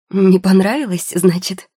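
громко и выше, с возмущением переспросить; переспросить услышанное с вызовом, громко; возмущённый переспрос. A narrator's reading. (возмущённо переспрашивая)

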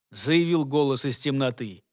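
plain reading voice

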